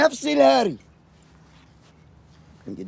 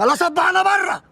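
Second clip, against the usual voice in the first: higher, louder snapping retort; angry shout; 9 semitones higher, 6 dB louder than usual. Acted. Husband snapping back at his wife, shouting. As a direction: shout him down.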